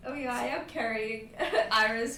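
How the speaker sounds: usual speaking voice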